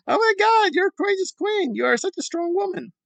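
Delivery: mock gushing fan. Mocking fans praising the protester, gushing falsetto. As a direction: swoon over her like an adoring fan.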